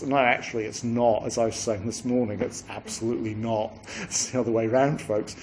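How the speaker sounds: normal talking voice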